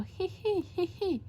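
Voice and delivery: high, soft hee hee giggle; a hammed-up giggling elephant. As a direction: titter it lightly, up high. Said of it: "He began to giggle".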